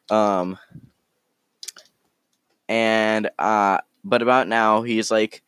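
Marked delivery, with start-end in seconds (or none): none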